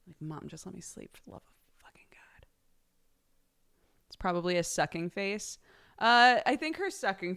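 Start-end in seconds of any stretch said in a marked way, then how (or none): 0.2-2.4 mock-exasperated whisper; whispered baby's plea. Baby begging mom for sleep, in a pretend whisper.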